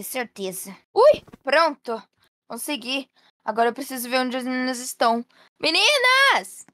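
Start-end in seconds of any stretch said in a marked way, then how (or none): 5.6-6.6 high, drawn-out call. Acted. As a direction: call out searching for the children. Mãe procura as meninas. (chamando alto, voz aguda)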